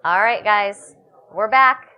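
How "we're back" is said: normal talking voice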